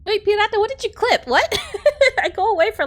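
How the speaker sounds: high, loud amused surprise; startled and laughing; surprised. Real, not acted. Returns to find a clip.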